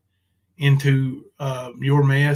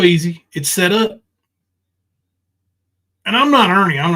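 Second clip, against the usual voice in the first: ordinary delivery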